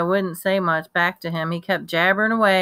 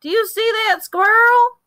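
squeaky high and loud with glee; excited country boy; 5 dB louder than usual. Acted. Boy points out a squirrel to Kenny, high and thrilled. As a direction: point it out like it's treasure.

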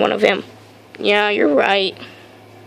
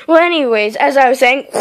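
ordinary delivery

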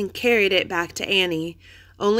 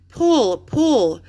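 urging; 5 semitones higher, 4 dB louder than usual. A narrator's reading. Urging sister out.

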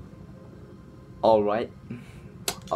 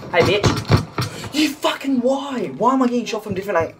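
furious yell; 11 semitones higher than usual. Real, not acted. Gamer shouting at the enemy who shot him, outraged. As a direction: scream at whoever shot you. Yelling at enemy after death.

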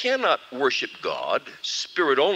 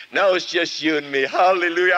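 relieved hallelujah; 5 semitones higher, 4 dB louder than usual. Acted. Rejoicing after ejecting goat.

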